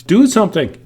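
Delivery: high, loud mock call; demonstrated yell. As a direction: punch it out like a pretend command. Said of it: "You would yell".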